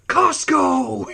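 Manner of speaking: high exclaimed mock movie line; Noble Johnson. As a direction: call it out like the film's chief. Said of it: "it's so funny the way he said it".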